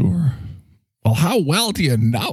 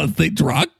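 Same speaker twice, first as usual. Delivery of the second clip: ordinary talk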